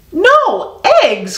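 high, aghast cry; dismayed cry. Acted. Farmer Brown hears the hens quit too, aghast high cry. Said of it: "cried Farmer Brown".

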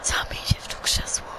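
mysterious unseen voice whispering; very soft, voiceless whisper. Pretend. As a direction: whisper it like a hidden spirit voice. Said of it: "znowu takie szepty".

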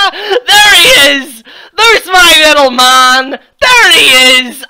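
loud, high hammed-up cheer; sarcastic shouted welcome; mock excited greeting. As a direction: greet him like a long-lost pal, mocking. Mock greeting of Polston on screen.

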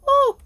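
very high loud pained whoop; shrill loud whoop. Real, not acted. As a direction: whoop as the heat peaks. Wasabi burn.

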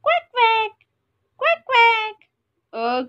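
high quacks; duck quacking. Shown as a demonstration. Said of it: "the sound that makes".

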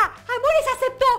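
high, bright announcement of joy; excited. Real, not acted. Vlogger telling fans the trade was accepted, thrilled. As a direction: burst out the good news.

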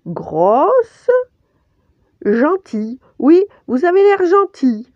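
high, halting rabbit apology; excuses hésitantes. A narrator's reading. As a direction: grope for polite words, high and loud. Pampan cherche ses mots pour s'excuser. (petit lapin qui cherche ses mots)

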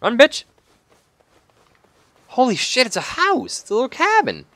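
very high-pitched excited exclaiming; thrilled discovery. Real, not acted. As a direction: squeal the news as rescue comes into view. Urges character on toward discovered cabin.

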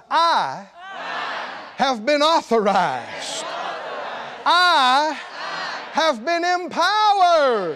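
loud, drawn-out proclamation; real loud. Shown as a demonstration. Preacher leads the congregation to say it real loud, a slow shout. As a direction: belt it out slowly for the crowd to echo. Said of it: "to say real loud".

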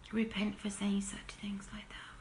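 a soft whisper urging repentance; whispered plea. Real, not acted. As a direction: breathe it, pleading and earnest.

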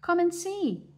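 high, loud duck call. Acted. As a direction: shout it high across the yard. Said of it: "called the other ducks".

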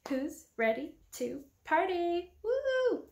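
hyped party cheer, slow build; rowdy party animal. Acted. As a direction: whoop it up like a party host. Party hype opener.

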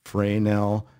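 Fresnel as FRAY-nel; "Fresnel" said fray-NELL; variant pronunciation. Shown as a demonstration. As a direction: open Fresnel with a long AY, no S. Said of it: "We've heard Fresnel".

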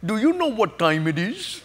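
imitated East Indian accent, unhurried; gentle question in a put-on East Indian accent. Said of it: "from an East Indian accent".

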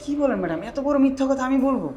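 high, loud disbelief; shocked protest. Acted. Guard asked by madam to tell a big lie, shocked. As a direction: balk aloud, pitch climbing.

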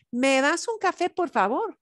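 "me das un café, por favor" rising at the end like a question; polite request. Shown as a demonstration. Teacher models ordering coffee, rising politely. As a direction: ask "por favor" with an upturn. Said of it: "me das is two syllables".

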